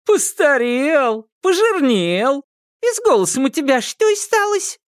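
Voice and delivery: high-pitched kikimora character voice; high storybook creature voice; Kikimora recognising someone. A narrator's reading. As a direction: size up an old acquaintance, drawing words out. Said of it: "узнала Кикимора".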